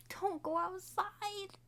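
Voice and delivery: high and slow, DON'T stretched out; high slow plea. Shown as a demonstration. Viewer warning the characters, high and slow. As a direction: plead Don't go outside, high and drawn out. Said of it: "warning take, then repeated whispered".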